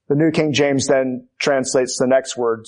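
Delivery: ordinary talk, nothing marked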